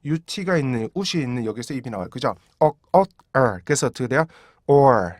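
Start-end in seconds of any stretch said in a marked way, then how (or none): 2.6-3.6 옥, 옷, 얼 as three separate beats; syllable drill. Shown as a demonstration. Teacher splits the word into beats for students. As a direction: break or into three stops. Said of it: "총 세 개예요".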